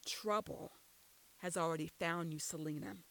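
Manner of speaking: ordinary delivery, nothing marked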